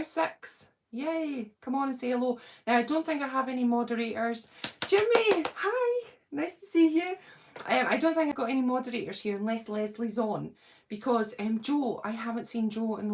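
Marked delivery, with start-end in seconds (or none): 4.9-7.2 high, loud welcome to a friend; excited greeting. Real, not acted. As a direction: squeal a happy hello. Greeting viewer joining live.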